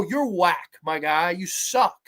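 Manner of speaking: ordinary talk, nothing marked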